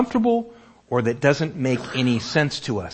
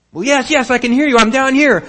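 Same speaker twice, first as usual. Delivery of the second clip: calling up from below; 13 semitones higher, 10 dB louder than usual. Acted. Jack answers from the cliff.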